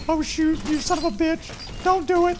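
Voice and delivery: very high falsetto wail; Paul Bearer. Retold or imitated. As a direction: squeal it out in wrestling-manager falsetto. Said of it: "has this very high-pitched voice".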